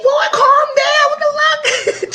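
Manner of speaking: high, loud, slow crying shout; shocked shout. Real, not acted. Viewer yelling at the fight on screen, high and slow. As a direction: yell it out, half sobbing in shock.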